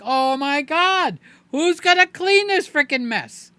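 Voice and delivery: hammed-up dismay at the mess; mock panic. After castor oil, mock horror at the mess, loud and high. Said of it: "It's like".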